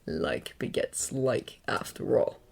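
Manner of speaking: low, slick menacing voice; sinister villain voice. A narrator's reading. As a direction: purr it like a killer. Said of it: "Stain says".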